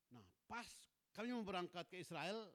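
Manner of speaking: normal talking voice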